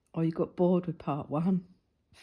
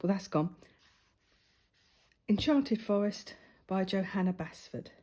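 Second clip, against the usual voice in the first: usual speaking voice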